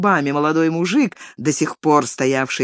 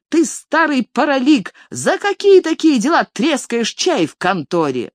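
angry woman; 7 semitones higher than usual. A narrator's reading. Vasilisa, a peasant woman, furiously scolding the elder. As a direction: lay into him, voice up high.

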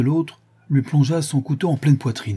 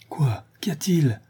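startled exclamation; 5 semitones higher than usual. A narrator's reading. Baron rushing out with servants, startled questioning. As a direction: pitch jumps up in alarm. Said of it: "s'écria le baron".

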